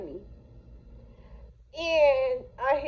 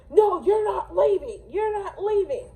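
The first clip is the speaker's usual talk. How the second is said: higher mock shout of the boyfriend; boyfriend blocking; 7 semitones higher than usual. Boyfriend refusing to let her leave, higher mock shout. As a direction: bark it like he's blocking you. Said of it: "And the boyfriend".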